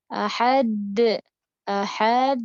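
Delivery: ahad with the d bounce stretched out; wrong lengthened ahad. Shown as a demonstration. Teacher demonstrating the wrong lengthened ahad. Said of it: "salah ya".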